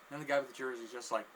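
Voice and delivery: usual speaking voice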